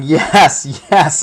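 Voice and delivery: lightly voiced gloating chant; yelling yes. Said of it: "he's yelling".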